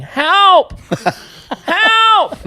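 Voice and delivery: high, loud yells of Help!; mock yell for help. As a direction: yell Help! into the dark like a lost kid. Lost soldier yelling for help.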